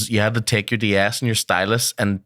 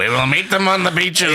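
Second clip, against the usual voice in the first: high, mock-rousing war speech; hammed-up patriotic oratory; Churchill; 8 semitones higher than usual. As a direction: declaim it like a wartime leader. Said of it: "you can hear Churchill playing in his head".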